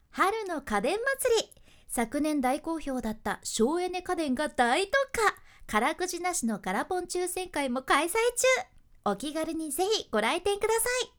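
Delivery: loud, high store ad read; every line ends on the same high note; ad narration. Shown as a demonstration. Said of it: "語尾の音が全部同じ".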